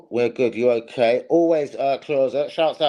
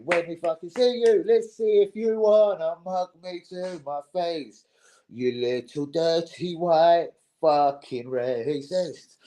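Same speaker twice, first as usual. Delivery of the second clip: high, slow put-on menacing taunt; mock threat; 5 semitones higher, slower than usual. Calling out Lee on stream, slow high taunting voice. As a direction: drag the insults out to taunt him.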